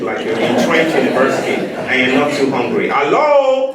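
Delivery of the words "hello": "Hello" called out very high and loud; call to the crowd. Shown as a demonstration. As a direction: lead the audience with a high ringing call. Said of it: "One more time, like you mean it".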